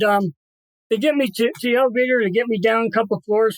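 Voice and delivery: ordinary talk, nothing marked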